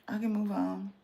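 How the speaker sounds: I can move on, said softly with a lift on can; soft, low, dismissive I can move on; quiet repeat. Shown as a demonstration. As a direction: drop to a soft murmur, lifting can.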